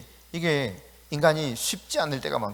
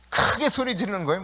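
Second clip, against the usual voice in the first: laughing through the words; amused, breaking into a chuckle; 3 semitones higher than usual. Real, not acted. Preacher joking about shouting in fights, laughing.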